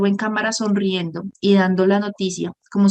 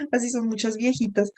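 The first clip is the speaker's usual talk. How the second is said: softer words through real laughter; laughing; 3 semitones higher, 4 dB quieter than usual. Real, not acted. Laughing at the grumpy-old-lady joke.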